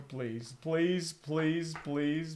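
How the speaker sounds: ordinary talk, nothing marked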